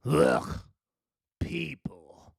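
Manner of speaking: a disgusted scoff that fades to a breath. A narrator's reading. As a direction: sneer at the children coming near.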